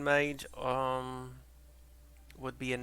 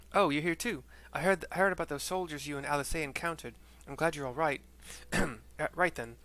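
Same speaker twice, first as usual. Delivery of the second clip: put-on higher, louder earnest young man; young villager voice; 4 semitones higher, 4 dB louder than usual. Streamer voicing Issei greeting the hero, raised earnest voice.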